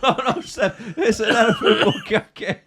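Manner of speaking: high, squeaky, laughing mock panic; Nobby's panicked voice. Podcaster imitating Nobby squealing at Seb, high and laughing. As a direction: squeal it out in a silly high voice while cracking up. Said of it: "he goes".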